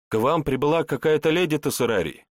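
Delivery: lightly voiced nervous recruit; a hint of a shy young soldier. Recruit announces a lady.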